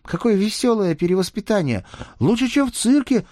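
put-on high, loud gasping kid voice; breathless child. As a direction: squeal it out of breath. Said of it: "с трудом выдохнул он".

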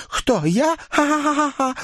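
put-on high girlish laughter; ringing laugh. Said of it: "Звонко рассмеялась".

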